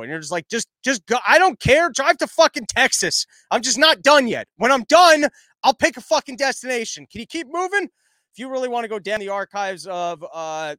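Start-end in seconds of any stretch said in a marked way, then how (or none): 0.5-7.9 loud, exasperated rant at the driver; exasperated yelling. Retold or imitated. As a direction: shout it like a fed-up passenger. Frustrated passenger telling driver drive.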